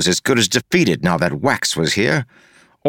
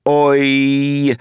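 higher, drawn-out mimicked "Oi"; mocking echo; 5 semitones higher than usual. A narrator's reading. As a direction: parrot his accent to needle him. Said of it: "Stop that!".

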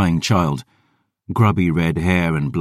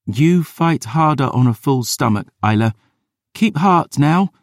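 put-on higher, firm young voice; rally her gently; bright hopeful girl; encouraging girl; 11 semitones higher, 3 dB louder than usual. Dior encouraging.

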